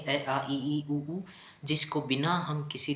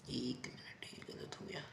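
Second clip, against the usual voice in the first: hushed off-mic fix; whispered aside; 15 dB quieter than usual. Real, not acted. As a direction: whisper to yourself while correcting. Aside fixing a mistake.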